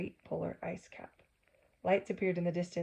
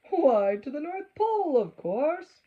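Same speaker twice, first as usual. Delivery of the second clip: high, loud, jolly conductor voice; big storybook conductor; conductor's answer; 9 semitones higher, 12 dB louder, slower than usual. Acted. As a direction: announce it grandly like a cheerful conductor. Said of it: "was his answer".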